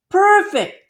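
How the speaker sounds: high, exultant mayor cry; mayor's delight. Acted. Mayor cheering the dangling worker, high and exultant. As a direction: burst out like a thrilled mayor.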